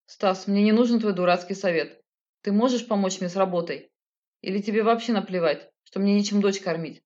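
ordinary delivery, nothing marked

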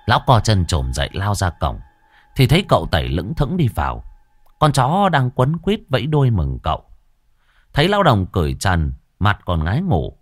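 plain reading voice

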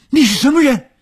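high, loud, harsh yell; harsh shout. Acted. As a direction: bark it at the stranger. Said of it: "他厉声地吼着".

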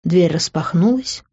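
ordinary delivery, nothing marked

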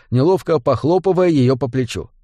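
usual speaking voice

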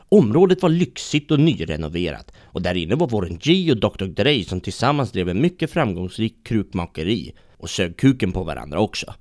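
normal talking voice

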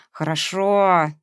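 slow, grudging hiss through teeth; hissed grudging okay. Acted. Сквозь зубы, шипя. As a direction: Veronika reluctantly gives in to Masha, hissing. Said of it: "прошипела Вероника".

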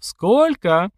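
high, called-out question; calling out. A narrator's reading. Sister asking the fight organiser the stakes, calling out high. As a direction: shout the question across to someone. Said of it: "позвала Лерка организатора боёв".

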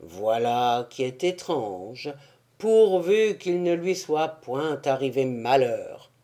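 low worried character voice; low and uneasy; Blasius voice. A narrator's reading. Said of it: "fit Blasius".

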